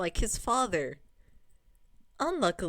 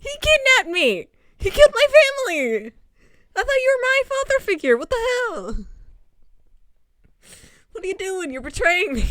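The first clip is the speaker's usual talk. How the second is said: much higher and louder, near-screaming betrayal; furious outburst; 13 semitones higher, 11 dB louder than usual. Pretend. Player screaming at Lancelot for betraying her, shrill furious shout. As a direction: yell it at him like he stabbed you in the back. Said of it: "And I'm just like".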